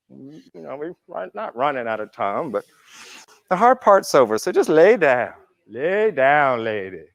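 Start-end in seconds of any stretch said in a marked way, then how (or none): 5.7-7.0 imitating Guruji. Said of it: "Guruji would always talk like that".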